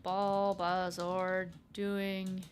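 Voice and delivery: very slow, drawn-out meme line; slow deadpan meme read; meme delivery. Acted. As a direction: stretch every word like a meme punchline. Quoting the meme slowly.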